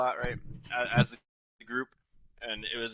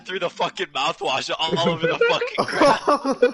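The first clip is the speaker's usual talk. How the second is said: laughing while telling; 6 semitones higher, 8 dB louder, slower than usual. Real, not acted. Recalling a funny moment, laughing.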